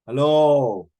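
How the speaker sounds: loud, indignant hello; loud reproachful "hello"; exasperated callout. Real, not acted. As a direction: call them out sharply with a loud hello. Scolding people for racist remarks.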